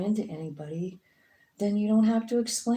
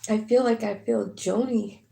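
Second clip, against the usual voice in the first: fighting back real tears mid-sentence; tearful, trailing off into an unvoiced word; choked up; 4 semitones higher, 5 dB louder than usual. Real, not acted. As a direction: voice catching with emotion, rising high then fading to a breath. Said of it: "I don't know why I'm getting so emotional".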